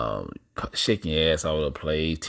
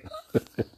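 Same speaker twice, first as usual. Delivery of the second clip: high laugh; 4 dB quieter than usual. Real, not acted. Laughs after his quote.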